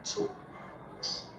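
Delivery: breathy whisper of talks; "Talks" whispered to herself; whispered word. Real, not acted. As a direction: whisper "Talks" while counting syllables. Child sounds out word, whispered.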